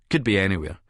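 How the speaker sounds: normal talking voice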